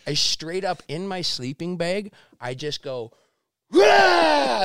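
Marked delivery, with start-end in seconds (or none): 3.7-4.6 high, loud all-out roar; bursting battle cry; primal yell. Retold or imitated. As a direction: roar as hard as you can. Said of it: "as hard as I can".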